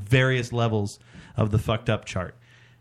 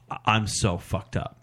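lightly voiced drunk, slow and lower; imitating drunk friend; 5 semitones lower, 3 dB quieter than usual. Drunk friend admits his state on the walk, imitated slow drunk. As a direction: play a hint of slow, subdued drunkenness.

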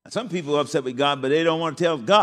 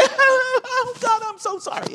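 high, loud, sorry sinner; hammed-up apology to God, pitched way up; whining sorry prayer; mock repentant wail; 5 dB louder, much slower than usual.

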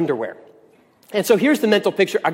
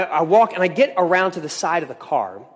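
ordinary talk, nothing marked

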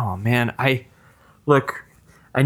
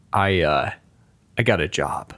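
ordinary delivery

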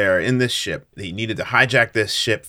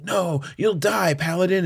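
dramatic warning; 8 semitones higher than usual. Retold or imitated. Covid pleading with the Paladin, hammed-up warning. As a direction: stretch the warning out in alarm.

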